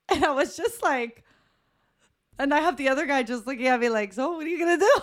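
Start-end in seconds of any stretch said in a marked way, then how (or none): 4.1-5.0 imitated question, pitched high through laughter; laughing mock challenge. Other guy eyes her on the boat, laughing mock challenge.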